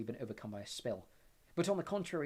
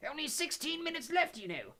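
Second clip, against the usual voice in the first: high, fretful professor voice; anxious; 4 dB louder than usual. A narrator's reading. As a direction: protest anxiously at the unruly class. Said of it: "said Professor Tofty anxiously".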